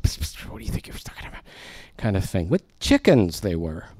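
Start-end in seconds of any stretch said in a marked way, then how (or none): 0.0-1.4 fast secretive whisper out of the side of the mouth; conspiratorial whisper. Pretend. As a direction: lean in and whisper, not wanting Jesus to hear. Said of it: "So they just sort of".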